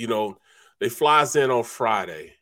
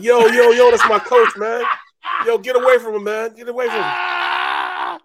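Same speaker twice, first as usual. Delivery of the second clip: loud, high street shout; shouting cousins; 9 semitones higher, 8 dB louder than usual. Retold or imitated. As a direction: yell at them to back off.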